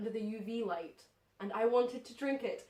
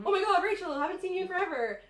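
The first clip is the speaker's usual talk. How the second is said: high, eager retold greeting; excited greeting; 7 semitones higher, faster than usual. Guy greeting Rachel in the club, mock excited. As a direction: gush like an old friend spotting her. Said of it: "he comes up to me and he goes".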